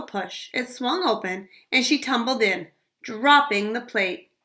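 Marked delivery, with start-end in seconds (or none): none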